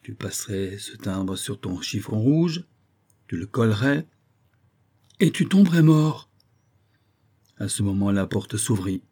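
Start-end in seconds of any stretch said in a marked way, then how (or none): 5.2-6.3 higher, louder punch line; dramatic climax. Acted. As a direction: land the fatal conclusion hard.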